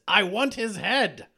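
hammed-up vengeful wish; mock angry demand. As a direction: act out an angry man's demand, drawn out. Retelling an angry man's wish.

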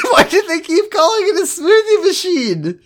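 high-pitched squeal through laughter; laughing disbelief. Real, not acted. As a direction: wail it incredulously while cracking up. Cracking up at the absurd name.

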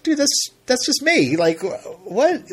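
high mock-bewildered dude voice; baffled celebrity. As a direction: play him confused, slightly offended. Celebrity puzzled about his face.

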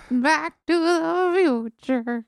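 put-on slow, high old lady voice; grandma voice. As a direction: play it as a little old granny. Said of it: "You're such a good grandma voice".

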